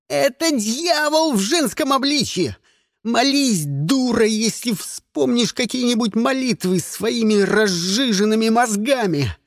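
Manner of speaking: high-pitched, fevered warning; strained, scornful tirade; frantic angry rant. Acted. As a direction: spit it out like a desperate zealot. Frantic boy rants at hostage.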